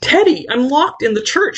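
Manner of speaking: high, panicked cry for help; a hammed-up high frightened plea; frightened shriek. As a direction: shriek it, trapped and terrified. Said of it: "she shrieked".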